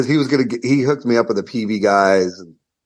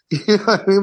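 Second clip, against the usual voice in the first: usual speaking voice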